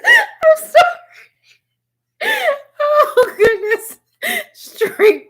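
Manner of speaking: high, slow, squealing laughter through the words; crying with laughter. Real, not acted. Tarot reader to her viewer Vincent, crying with laughter. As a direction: laugh till the tears come. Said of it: "I cried 'cause that was hilarious".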